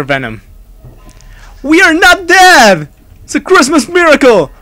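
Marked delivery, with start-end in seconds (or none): 1.6-4.5 high, loud disbelieving cheer; elated shout. Real, not acted. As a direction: cry out in relief at surviving. Sudden survival in horror game.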